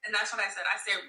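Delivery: ordinary talk, nothing marked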